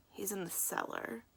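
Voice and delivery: Maya answers quietly, giving in. A narrator's reading. Maya gives up Finn to the crows, very quietly. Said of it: "Maya's voice was very quiet".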